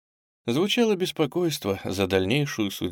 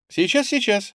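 high, quick answering call; girl calling back; 12 semitones higher than usual. A narrator's reading. Girl answering the headmistress's call, high and ready. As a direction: answer brightly from the next room.

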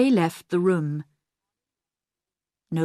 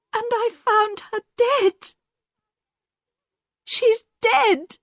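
panicked cry. Acted. Diane finds her mother dead.

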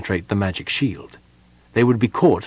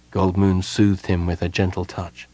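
plain reading voice

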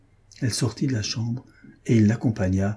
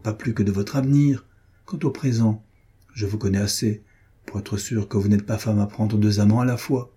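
ordinary delivery

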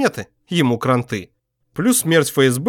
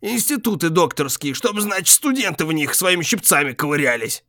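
grumbling; 4 semitones higher than usual. A narrator's reading. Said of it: "пробурчал он".

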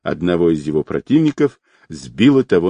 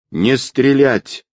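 drawn-out, higher-pitched order; rough command; 6 semitones higher, slower than usual. A narrator's reading. Man in the bushes orders a ceasefire, rough drawn-out command. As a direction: call out a rough order, stretching it. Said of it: "раздался грубый голос в кустах".

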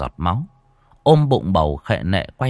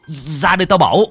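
loud, rising frightened shouts to the family; panicked calling; 6 semitones higher, 5 dB louder, faster than usual. A narrator's reading. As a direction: yell for everyone in panic. Ông gọi cả nhà hoảng hốt. (ông hoảng hốt gọi to cả nhà)

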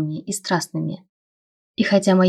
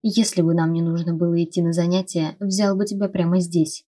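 usual speaking voice

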